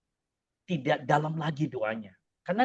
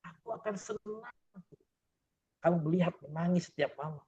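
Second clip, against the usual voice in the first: high, gently teasing answer from above; God's voice, higher and lightly playful; voicing Allah's reply; 5 semitones higher than usual. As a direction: play the divine voice answering the prayer, pitched high. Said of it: "kata Allah".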